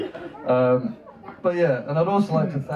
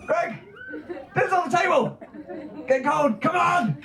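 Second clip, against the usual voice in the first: yelling for dinner. Retold or imitated. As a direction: shout it right in his face.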